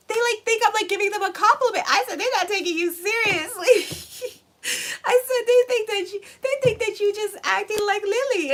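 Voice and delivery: high, squealing voice on the edge of laughter; helpless amusement. Real, not acted. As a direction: struggle to tell it while cracking up. Retells funny scene, cracking up.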